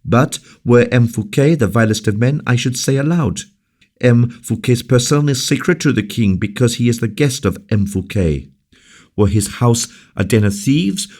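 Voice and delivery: high, fast impassioned speech; passionate defense. Acted. La Valliere to Colbert, high passionate defense. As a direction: defend the guest with fire. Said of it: "passionate energy of her voice".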